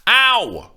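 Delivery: put-on sharp loud old woman's ow; the nurse yelping in pain. A narrator's reading. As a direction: yelp as your arm is squeezed. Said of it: "yelped the victim".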